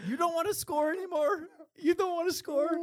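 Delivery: very high, squealed impression of a mad teammate; mocking high-pitched indignation; mock angry teammate. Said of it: "you actually got mad at me".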